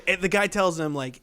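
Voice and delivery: ordinary talk, nothing marked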